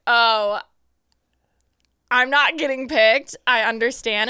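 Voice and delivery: slow, mock-tragic acceptance; dramatic resignation. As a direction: sigh it out theatrically.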